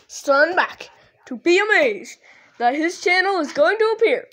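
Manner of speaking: showman announcer. Acted. Announcing to viewers, showman style.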